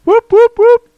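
high, loud celebratory whoops; loud cheering whoops. Real, not acted. Cheering greeting at show start.

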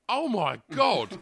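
slow, loud, high shocked exclamation; shocked gasp. Retold or imitated. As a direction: drag it out in disbelief. Lad jumps off stairway.

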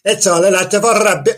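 hammed-up Welsh lilt, rolling the r; mock Welsh accent. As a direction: scoff in an exaggerated Welsh accent. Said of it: "Obviously, he said that in Welsh".

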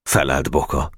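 plain reading voice

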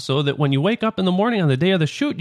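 usual speaking voice

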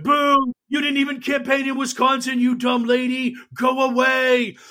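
loud, high mocking yell; jeering heckler. Crowd jeering at Hillary, loud high heckling shout. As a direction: boo her off the stage like a heckler. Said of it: "everyone's like".

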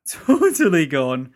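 loud, drawn-out amused outburst; laughing. Real, not acted. Podcaster laughing at derailed round-up, loud and amused. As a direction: blurt it slowly through a laugh.

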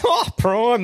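high, playful surprise; mock surprise. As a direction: act shocked for a laugh. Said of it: "I went".

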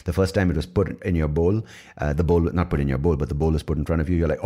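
normal talking voice

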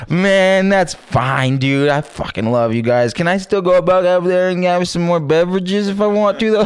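put-on high, loose drunk affection; sloppy drunk cheer. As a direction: wave off the firing happily. Drunk reply to being fired.

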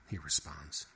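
ordinary delivery

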